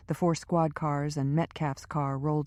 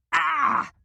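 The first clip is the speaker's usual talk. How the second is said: a sudden, much higher, louder yelp of pain; pained cry; 13 semitones higher, 6 dB louder than usual. A narrator's reading. Powers crying out as Lynch squeezes his shoulder, sharp pained yelp. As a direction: let it burst out as the shoulder is squeezed. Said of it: "Powers cried out".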